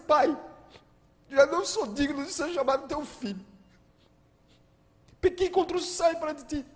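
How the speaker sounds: high, pleading tearful plea; tearful son. Acted. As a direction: beg the father's forgiveness through tears. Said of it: "imagina ele chorando".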